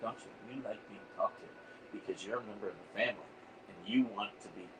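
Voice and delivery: ordinary talk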